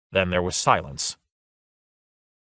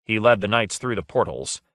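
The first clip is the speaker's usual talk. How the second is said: plain reading voice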